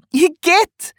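very high, loud squawk; owl's startled yelp. A narrator's reading. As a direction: squeal in disgust. Owl hit by dung.

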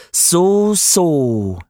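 high, drawn-out snake lisp; a sly serpent sizing him up; slushy lisp. A narrator's reading. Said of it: "sagte die Schlange".

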